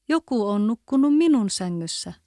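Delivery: usual speaking voice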